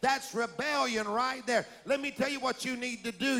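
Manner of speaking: ordinary talk, nothing marked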